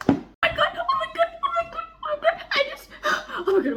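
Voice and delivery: high, breathless oh my God over and over; giddy squealing. Real, not acted. Reader telling viewers she needs to calm down, squealing. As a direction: gush like you can't calm down. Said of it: "I need to calm down".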